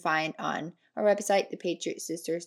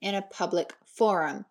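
usual speaking voice

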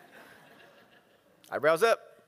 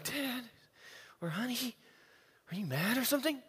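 higher, softer timid question; worried family; 5 semitones higher, 8 dB quieter than usual. Retold or imitated. Family members asking Dad if he's mad, timid and soft. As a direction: ask gently if Dad is angry.

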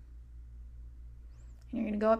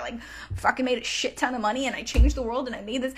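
louder, gushing and thrilled; mock elated soul at life's end; excited retelling; 4 dB louder than usual. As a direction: rave about a wild life. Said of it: "you're like".

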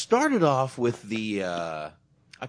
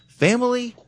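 much higher and louder, a disbelieving cry; incredulous exclamation; 9 dB louder than usual. Real, not acted. Stressing to the co-host how family matters, incredulous high cry. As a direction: fling the word out in disbelief.

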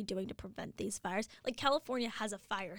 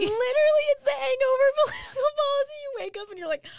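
high, loud laughing delivery; 12 dB louder than usual. Real, not acted. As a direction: crack up while repeating the joke. Laughing at cohost's joke.

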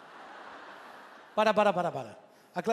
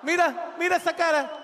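excited shout; 9 semitones higher, 4 dB louder than usual. Real, not acted. Laughing at friend's face.

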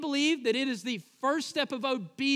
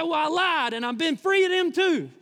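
high, loud, brazen movie convert; Delmar; 5 semitones higher, 5 dB louder than usual. Retold or imitated. Baptized crook admitting he lied, high loud mock triumph. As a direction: belt out the punchline, pitched up and loud. Acts out Delmar's punchline reply.

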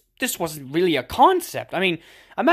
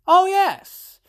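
excited exclamation; 13 semitones higher, 7 dB louder than usual. Acted. Sudden remembering exclamation.

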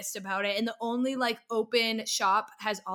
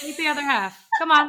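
louder, pushy retold goading; insistent peer pressure; 5 semitones higher, 9 dB louder than usual. Narrator goads a friend to take more, insistent peer pressure. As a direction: egg them on, punching it out.

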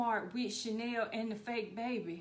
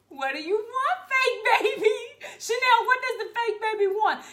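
put-on high, loud panicked questions to the baby; pleading with a toy baby, rising up high; frantic questioning; 11 semitones higher, 7 dB louder than usual. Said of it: "Me and Bria in the front seat like".

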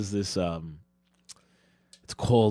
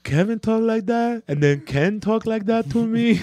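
put-on high, slow girlfriend voice; slow and high as her; girlfriend impression; 12 semitones higher, 3 dB louder, slower than usual. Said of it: "the impression that I do of you".

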